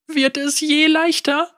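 put-on high, tear-choked child; a sniffling child's hope; tearful girl. A narrator's reading. Girl asks after sniffling.

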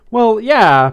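high, loud, hesitant agreement; nervous. A narrator's reading. Skunk half agrees with Blythe's enthusiasm, nervous. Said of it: "Pepper said nervously".

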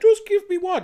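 put-on high, loud wheedling kid; a child begging for a treat; little boy voice. Voicing the son asking his mom for a cookie, childish.

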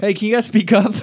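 loud, high holler; calling out. Real, not acted. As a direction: shout it to people outside. Calling to men outside.

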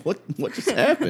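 high-pitched mock bewilderment; baffled surprise. Waking to season four, baffled surprise. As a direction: act startled and lost, voice jumping up. Acts out a baffled binge watcher.

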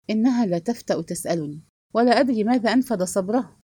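ordinary delivery

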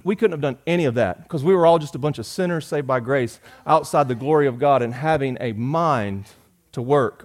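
ordinary talk

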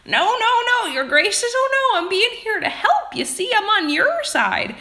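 put-on very high, loud oddball old man; weird old man. Hunchback pleading to be on the troopers' side, weird old man voice. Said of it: "going back to my weird old man".

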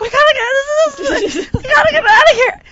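high, loud panicked babble; hysterical. Retold or imitated. Woman trapped with a spider in the canoe, hysterical. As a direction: shriek to escape, stumbling over words. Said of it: "I'm hysterical".

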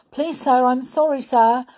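high, loud apology; pleading pupil. A narrator's reading. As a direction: beg the teacher for mercy. Winks begging off punishment.

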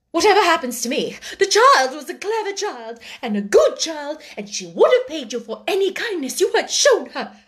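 high-pitched, pressing on with the tirade; worked-up, emphatic reproach; reckless courage. A narrator's reading. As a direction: blurt it all out boldly, come what may. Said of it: "with a kind of reckless courage".

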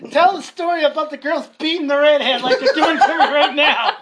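high, loud plea through laughter; helpless laughing yell; laughing shout. Real, not acted. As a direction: holler it while cracking up. Shouting through laughter while teased.

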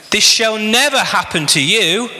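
acted insistent objection; rebuking. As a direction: rebuke him with pitch lifted, at normal pace. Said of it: "began to rebuke him".

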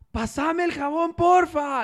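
high, slow, loud penguin voice; penguin asking. Acted. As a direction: plead slowly for the soap in a high voice. Pingüino pide el jabón. (pingüino pide el jabón, agudo y lento)

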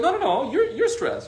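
high-pitched acted retort; insistent denial. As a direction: imitate someone pushing the blame back. Acted back-and-forth argument over stress.

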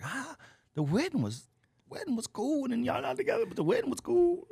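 high teasing sing-song voice; mocking teaser.

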